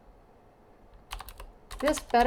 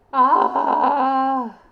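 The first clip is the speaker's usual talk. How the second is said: frustrated yell; 2 semitones higher, 8 dB louder than usual. Real, not acted. Frustrated by game.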